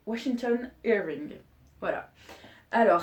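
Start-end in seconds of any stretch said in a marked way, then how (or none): 0.1-1.3 slow, deliberate English-accented name. Shown as a demonstration. Vlogger offering the author's name as a demo, English accent. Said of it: "mon accent, je peux te faire aussi l'anglais".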